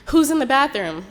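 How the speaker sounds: loud, high call through a door; retold shout from outside the door. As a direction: demand it loudly, as someone knocking. Friend calling through the door.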